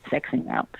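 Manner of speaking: sexing out whispered, trailing off; whispering. Shown as a demonstration. Repeated phrase, whispered last take.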